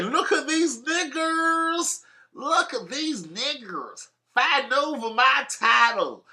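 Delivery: slow mocking deep South accent voice. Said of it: "I always do it in a deep South accent".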